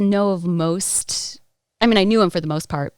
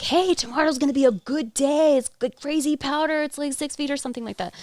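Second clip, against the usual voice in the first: higher-pitched, excited retold housemate; eager imitation; 8 semitones higher than usual. Housemate pitching a powder day for tomorrow, eagerly imitated.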